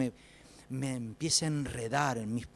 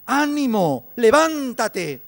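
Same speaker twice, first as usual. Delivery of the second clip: high, drawn-out shout; rousing call; 10 semitones higher, 16 dB louder, much slower than usual. Acted. Crowd telling the blind man to get up, rousing shout. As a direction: call it out at full voice to rouse someone.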